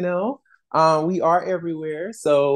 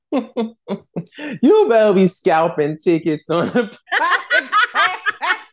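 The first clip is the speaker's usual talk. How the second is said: cracking up mid-sentence, voice shooting up high; laughing; 8 semitones higher, 4 dB louder, slower than usual. Real, not acted. Teasing a co-host about scalping tickets, laughing. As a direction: tease through helpless laughter.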